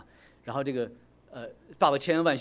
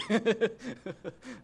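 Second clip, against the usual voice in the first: laugh; 8 semitones higher than usual. Real, not acted. Laughs about loving thrill rides.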